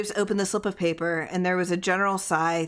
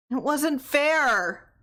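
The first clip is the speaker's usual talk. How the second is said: high, loud, drawn-out protest; 9 semitones higher, 4 dB louder, much slower than usual. A narrator's reading. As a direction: stretch out the complaint. Tessie's protest repeated.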